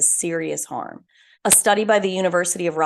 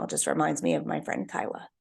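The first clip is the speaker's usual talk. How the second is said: choked up; 13 semitones lower, 7 dB quieter than usual. Real, not acted. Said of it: "Sorry, I'm getting emotional".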